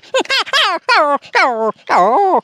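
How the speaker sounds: high yelping puppy cry. Acted. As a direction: yelp high like a struck puppy. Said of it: "बच्चा चिल्लाने लगा".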